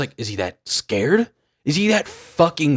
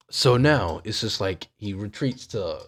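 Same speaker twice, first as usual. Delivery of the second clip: normal talking voice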